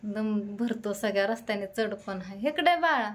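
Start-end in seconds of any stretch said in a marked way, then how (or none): 2.5-3.1 loud high call to the little one. Real, not acted. Calling child over. (बाळाला मोठ्याने, उंच आवाजात हाक मारणे)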